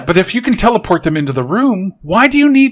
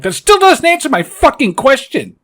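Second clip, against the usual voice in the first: high-pitched exasperated outburst; 9 semitones higher than usual. Acted. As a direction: demand a real answer, voice pitched up. Exasperated rant at film dialogue.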